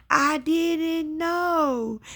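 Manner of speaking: high, slow retold wail; begging forgiveness. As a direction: imitate a remorseful mother's drawn-out plea. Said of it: "She begged me to forgive her".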